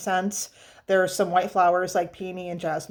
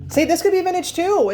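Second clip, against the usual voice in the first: excited realisation; 11 semitones higher, 6 dB louder than usual. Real, not acted. Sudden realisation about the bottle.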